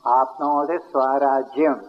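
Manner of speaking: slow, deliberate Sanskrit recital; slow serene guru pauses. Shown as a demonstration. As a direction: let each syllable settle, unhurried. Recites Sanskrit phrase slowly.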